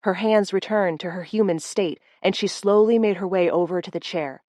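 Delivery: plain reading voice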